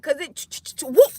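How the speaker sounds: stuttering clicks into a loud whoop; flying shoe noise. Shown as a demonstration. Speaker shows shoe rounding the corner, whooshing sound effect. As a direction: imitate something whizzing round a bend.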